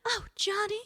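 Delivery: high swooning fifties damsel; fluttering cries for help. Retold or imitated. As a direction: coo it like an old movie heroine. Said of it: "a fifties era movie".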